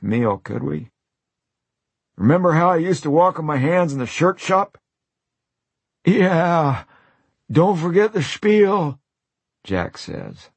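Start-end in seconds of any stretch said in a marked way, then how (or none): 2.2-4.8 eager reminiscing. Acted. Johnny recalls his trick eagerly. 6.0-9.0 high, drawn-out delighted urging; high, slow urging. Acted. Jack urges Johnny, delighted.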